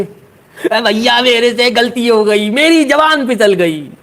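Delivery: high, slow, put-on pleading voice; whiny apology. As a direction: beg forgiveness like a caught kid. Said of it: "कैसे?".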